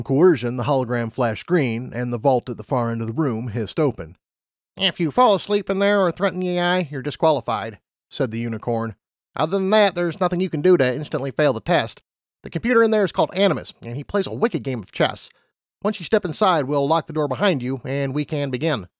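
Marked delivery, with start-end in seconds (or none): 4.8-7.8 higher, matter-of-fact lab pony; brisk scientist explaining rules; lab unicorn. Acted. 9.3-18.9 higher, matter-of-fact lab pony; brisk scientist explaining rules; lab unicorn. Acted.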